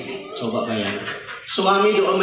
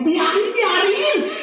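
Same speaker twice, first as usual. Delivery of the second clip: dismissive; 12 semitones higher, much slower than usual. Pretend. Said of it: "advises to let wife nag".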